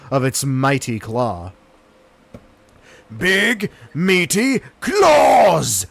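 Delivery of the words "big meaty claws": slow booming comic shout. Pretend. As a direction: drag out and bellow each word. Comic exclamation.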